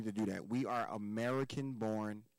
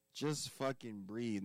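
Just fucking breathe drawn out slowly and deliberately; urging a listener slowly to just breathe; slow emphatic advice; much slower than usual. Shown as a demonstration. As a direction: lean on every word of Just fucking breathe, taking your time. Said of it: "my third thing I would say".

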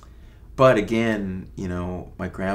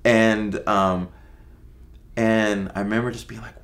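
normal talking voice

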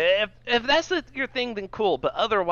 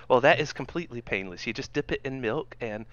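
ordinary talk, nothing marked

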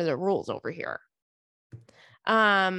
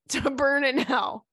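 ordinary talk

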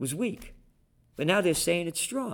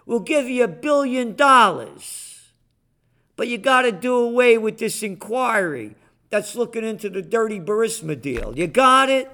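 higher, louder, pushy mock Biden voice; 6 semitones higher, 6 dB louder than usual. Radio host mimicking Biden strong-arming Ukraine, loud and pushy. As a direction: bark out the offer like a strong-arm deal. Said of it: "Biden goes over there and says".

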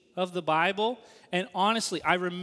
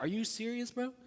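inward grumble; 4 semitones higher, 7 dB quieter than usual. Retold or imitated. Student privately scoffing at the intro class, quiet disbelief. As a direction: mutter it to yourself. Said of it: "Inwardly, I'm like".

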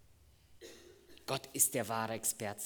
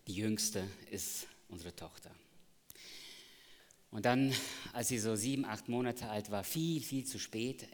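usual speaking voice

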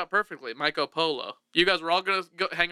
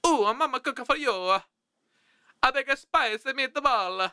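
spicy-meatball cartoon Italian; mock Italian; 8 semitones higher than usual. Host doing his Italian voice for laughs. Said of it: "Lori loves it when I do this voice".

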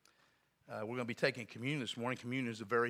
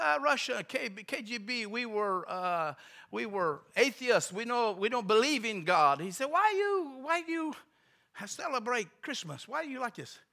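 put-on high, halting Russian-accented English; broken English; 10 semitones higher, 5 dB louder than usual. Ex-KGB atheist questioning clerk about Christmas, broken English accent. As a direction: imitate a baffled foreigner, drawn out in broken English. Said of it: "he spoke real broken English".

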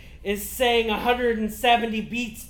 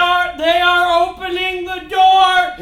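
panicked yell; 8 semitones higher, 11 dB louder than usual. Acted. Plant panics at diner.

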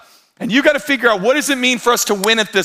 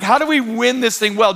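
usual speaking voice

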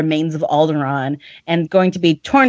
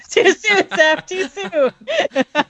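high, laughing too soon; half-crying laugh at a bad joke; laughing protest; 9 semitones higher, much slower than usual. Real, not acted.

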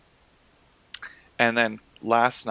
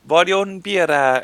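hammed-up Italian accent, higher and louder; breezy Italian brush-off; mock Italian; 8 semitones higher, 7 dB louder than usual. Brushing off the balcony woman in mock Italian. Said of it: "looked at her and went".

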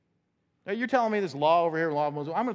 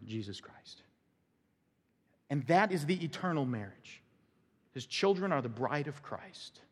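ordinary talk, nothing marked

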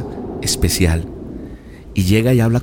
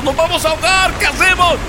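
much higher panicked cry; desperate. Acted. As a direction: scream it in terror of drowning. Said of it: "empiezan desesperados". (discípulos aterrados, voz muy aguda)

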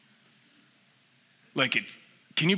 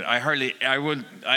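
usual speaking voice

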